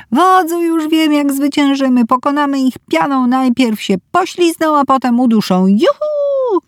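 put-on high, giddy child voice; excited little girl. A narrator's reading. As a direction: play a thrilled little girl in the bath. Little girl playing in bath. (podekscytowana mała dziewczynka)

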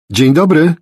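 loud, high call through a door; shouted greeting. A narrator's reading. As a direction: call out to whoever is inside. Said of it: "krzyknęła przez szparę".

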